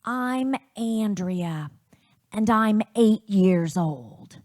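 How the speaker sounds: put-on slow, loud child's announcement; Andrea. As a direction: announce yourself slowly, like a kid on tape. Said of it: "my older sister, Andrea, say".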